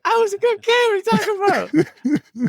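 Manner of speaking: very high, hammed-up falsetto protest; squeaky mock outrage. Retelling the mix-up to friends, shrill hammed-up falsetto. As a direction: squeal it like a wronged kid. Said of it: "And I was just like".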